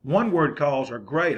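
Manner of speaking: usual speaking voice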